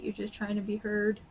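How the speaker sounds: normal talking voice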